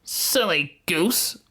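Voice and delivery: high, drawn-out witch scolding; scornful witch. A narrator's reading. Witch to hesitating Gretel, high slow scorn. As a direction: chide her slowly in a high witch voice. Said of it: "said the witch".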